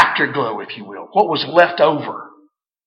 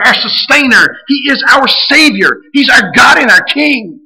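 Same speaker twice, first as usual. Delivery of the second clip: rising, louder preacher's litany; preaching at full force; 7 semitones higher, 5 dB louder than usual. Acted. Pastor preaching to the church, full force. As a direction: build the list like a sermon climax.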